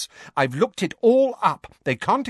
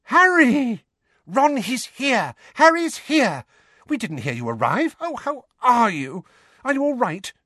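excited gush; 10 semitones higher than usual. A narrator's reading. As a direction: gush over him, thrilled. Hermione's excited outburst.

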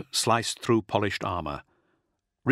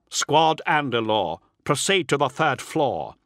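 higher-pitched battlefield order; lightly acted squad commander; commanding officer; 5 semitones higher, 3 dB louder than usual. A narrator's reading. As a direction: issue a firm order to the squad.

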